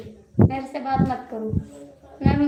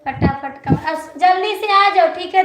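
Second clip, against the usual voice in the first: calling out to hurry; 4 semitones higher, 6 dB louder than usual. Real, not acted.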